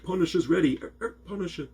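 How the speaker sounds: high-pitched vocal mimicry of a record scratch; scratched lyric imitation. Fan showing how the song's ending gets scratched, high mimicked scratch. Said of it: "so they scratch part of that".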